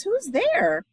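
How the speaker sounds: high squeaky cry; mouse crying out. Acted. Mouse startled by glowing eyes, high squeaky cry. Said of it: "Mouse cries".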